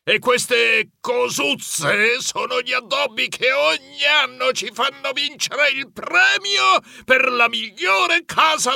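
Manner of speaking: slow menace rising to a high indignant shout; drawn-out, high-pitched irate old man; exploding grandpa. A narrator's reading. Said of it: "poi sbottò".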